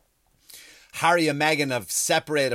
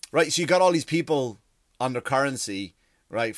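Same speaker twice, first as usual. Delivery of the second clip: normal talking voice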